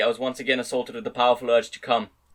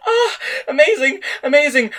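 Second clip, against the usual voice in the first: loud high cries; 12 semitones higher, 7 dB louder than usual. A narrator's reading. Woman cries out in love scene.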